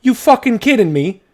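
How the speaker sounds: loud, high, indignant accusing shout; angry aristocrat. Acted. Pompous dwarf aristocrat catches a thief, furious shout. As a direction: bark the accusation at the thief, outraged.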